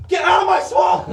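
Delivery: loud, high Scottish-ogre shout; Shrek. Retold or imitated. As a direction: bellow it in a comic Scottish accent. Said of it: "couldn't think of the accent in time".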